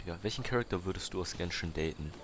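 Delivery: ordinary talk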